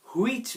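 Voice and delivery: "হুইচ" with a sounded h, "hu-ich"; mispronounced h. Shown as a demonstration. Teacher showing learners the common mistake, "হুইচ" with added h. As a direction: start "হুইচ" on a breathed "hu". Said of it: "হ আসবে না এখানে".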